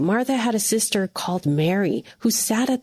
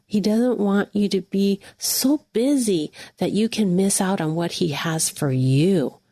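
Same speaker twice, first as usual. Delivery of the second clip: usual speaking voice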